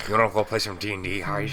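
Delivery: lisping gamer nerd voice; playful put-on nerd voice; nerdy lisp voice. Mocking lisping D&D player.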